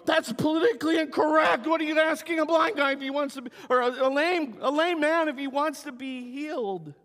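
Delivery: high, loud indignant huff; shrill mock scandal; mock outrage. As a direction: parody offended critics, hammed up. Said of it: "There's a lot of people go".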